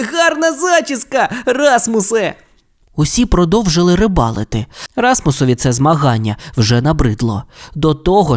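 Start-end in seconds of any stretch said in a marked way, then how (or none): 0.1-2.4 high teasing words through giggles; mock his friend while still laughing; laughing tease. Robin teasing Rasmus about his hair, giggling. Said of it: "Робін так сміявся".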